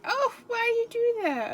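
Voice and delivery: high, slow squeaky little toy voice; plaintive squeak of a squished panda toy; tiny hurt plush creature whining. Retold or imitated. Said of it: "they're like".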